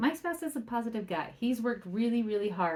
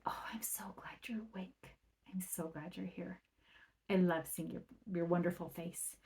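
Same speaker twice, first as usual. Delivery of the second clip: whispered, loving morning greeting; tender whisper; 10 dB quieter than usual. Retold or imitated. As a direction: breathe it softly to a waking child.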